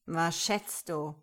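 slow, slightly slurred question; drunken mumble. A narrator's reading. Man asking woman to guess, murmuring as if drunk. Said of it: "Wie besoffen murmelte er".

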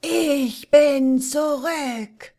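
slow, high, booming cry; triumphant shout. Acted. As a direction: roar it to the sky in triumph. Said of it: "schrie in den angehenden Morgen".

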